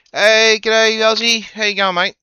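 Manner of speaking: loud, high, cheery greeting; cheery g'day. Real, not acted. Greeting his mate Ozzy on Discord, loud and cheery. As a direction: call out a big hello to a mate.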